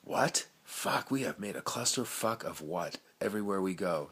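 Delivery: ordinary talk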